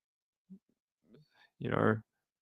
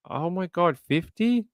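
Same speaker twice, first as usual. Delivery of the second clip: high, startled reaction; real surprise; 6 semitones higher than usual. Real, not acted. Reacting to a viewer's fifty staples, surprised. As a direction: pitch jumps up in disbelief at the number.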